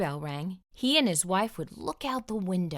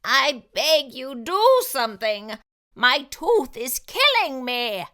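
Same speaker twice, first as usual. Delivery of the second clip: slow, loud, higher begging voice; begging fox in pain; 6 semitones higher, 7 dB louder than usual. A narrator's reading. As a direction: plead through a toothache.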